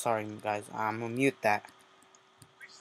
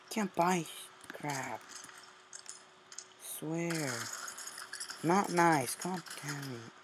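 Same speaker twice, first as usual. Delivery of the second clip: ordinary talk, nothing marked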